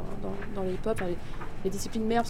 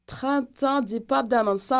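slow, higher, louder put-on Québécois accent; 4 semitones higher, 5 dB louder, slower than usual. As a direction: draw it out in a mock Quebec accent. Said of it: "Fais-le avec l'accent québécois".

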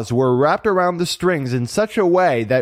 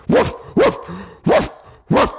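short high-pitched imitated woofs; dog barking. A narrator's reading. Huge dog heard by the kittens, barking. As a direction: bark in separate bursts like a dog. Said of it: "they heard a deep barking".

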